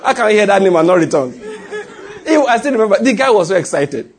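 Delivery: high-pitched telling through laughter; laughing while talking. Real, not acted. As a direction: tell it while cracking up. Retells funny story while laughing.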